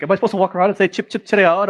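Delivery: high, hammed-up mock English accent; mock British accent. Friend mocking what English people supposedly say, in a hammed-up British accent.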